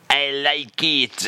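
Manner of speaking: slow, drawn-out French "I like it"; mock French accent. [name] agrees he likes the phrase, in a slow mock French accent. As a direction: purr it like a pleased Frenchman.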